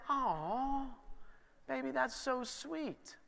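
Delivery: put-on soft, high and slow, melting over the child; tender aw. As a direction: coo it like a moved parent. Dad touched by daughter's words.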